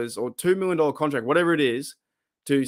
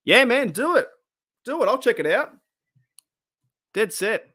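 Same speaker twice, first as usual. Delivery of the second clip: excited encouragement; 8 semitones higher than usual. Real, not acted. Cheering on Joey's video idea.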